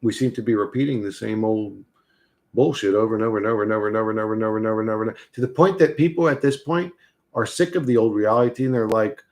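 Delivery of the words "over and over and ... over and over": fast run of over and over; fast repeated over and over; rapid-fire repetition. Real, not acted. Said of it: "rapid repetition for effect".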